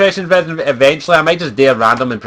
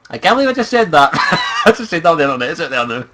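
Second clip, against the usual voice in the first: ordinary talk, nothing marked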